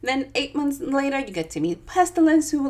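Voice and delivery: usual speaking voice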